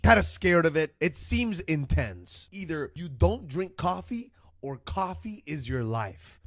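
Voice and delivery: usual speaking voice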